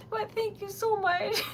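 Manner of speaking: high thanks tipping into tearful laughter; crying. Real, not acted. Streamer thanking viewers, no longer holding back tears. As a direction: let the tears break through the thanks. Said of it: "such a good job of not crying".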